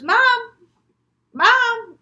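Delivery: high, loud searching call; calling for Mom. Acted. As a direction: call out for a mother who isn't answering. Child calls for missing mother.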